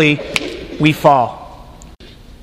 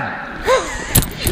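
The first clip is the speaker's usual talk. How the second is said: sharp comic gasp; 11 semitones higher, 8 dB quieter than usual. Acted. Abraham finally believes.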